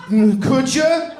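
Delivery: high, loud, very slow could ya; hammed-up annoyed businessman; passive-aggressive request. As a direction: drag it out pointedly.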